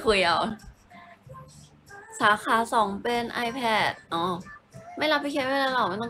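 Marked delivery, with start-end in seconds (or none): 2.2-4.2 drawn-out, slow reading aloud; slow reread. Real, not acted. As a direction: spell it out slowly to yourself. (อ่านช้าๆ ลากเสียง)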